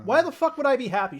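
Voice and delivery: furious shouted question, high and loud; angry yell. Acted. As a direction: yell it at him, fed up. Sister yells at Patch.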